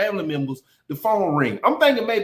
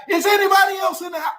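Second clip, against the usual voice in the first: cop yelling; 10 semitones higher than usual. Retold or imitated. Officer yelling the question while hauling him out. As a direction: shout it for no reason. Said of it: "Why is you yelling though?".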